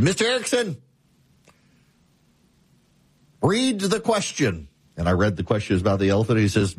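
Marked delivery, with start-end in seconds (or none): 0.0-4.7 stern professor. Retold or imitated. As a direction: drag it out like an exasperated law professor. Law professor grilling the student.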